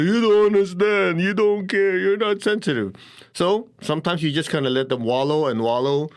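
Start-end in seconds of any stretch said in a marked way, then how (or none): 0.0-2.9 high, louder whining protest; mock whine, pitched up; whiny complaint. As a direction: imitate a sulking, self-pitying person. Miserable person complaining when pulled out.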